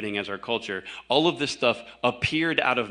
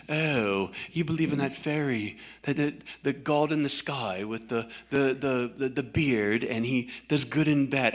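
mock British accent; 3 semitones higher than usual. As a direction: mimic a snobby British atheist. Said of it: "I think a British accent".

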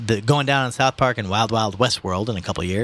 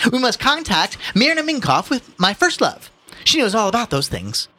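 pompous son; 13 semitones higher, 4 dB louder than usual. A narrator's reading. Son urging his mother to get help, pompous. Voices Ignatius.

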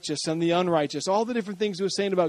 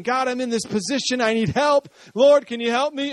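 David's plea; 7 semitones higher, 5 dB louder than usual. Retold or imitated. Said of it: "David's relating to us and saying".

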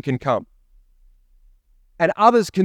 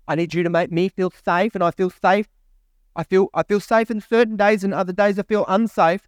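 mock needy voice; 4 semitones higher than usual. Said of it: "we like to be in our safe spaces".

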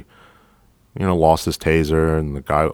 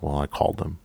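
mutters; 7 dB quieter than usual. Retold or imitated. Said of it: "and he mutters".

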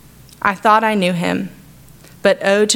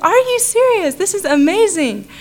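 much higher, thrilled disbelief; retelling her stunned joy at the news; shocked delight; 11 semitones higher than usual. Retold or imitated. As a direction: gasp it out in happy surprise. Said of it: "And that shocked me".

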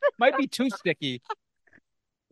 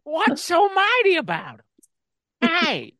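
Harry Carey impression; 9 semitones higher, 4 dB louder, slower than usual.

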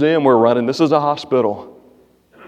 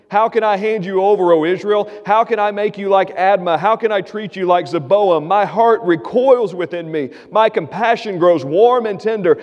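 raised, pleading reading of God's words; scripture reading; 6 semitones higher than usual. Acted. Pastor reading Hosea's plea to the congregation, raised and pleading. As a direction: proclaim God's pleading voice, higher throughout.

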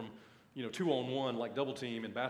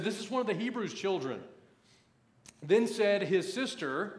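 raised, emphatic preacher's Bible reading; scripture reading; 2 semitones higher, 5 dB louder than usual. Acted. Preacher reads the story of Moses to his church, emphatic scripture reading. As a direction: read the verse out to a congregation with punch.

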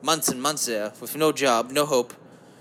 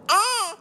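squeaky high yelp; sudden high yelp; 4 dB louder than usual. Acted. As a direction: yelp like something startled you. Sudden yelp mid-rant.

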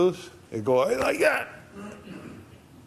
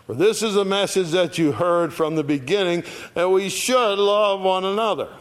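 raised, declaiming Bible reading; higher, louder preacher's reading of the verse; scripture reading; 6 semitones higher, 3 dB louder than usual. Acted. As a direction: proclaim the verse to the congregation. Reads scripture aloud.

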